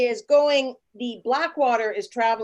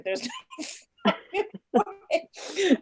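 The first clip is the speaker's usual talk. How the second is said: laughing refusal; 8 semitones higher, much slower than usual. Retold or imitated. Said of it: "the guy behind us is like".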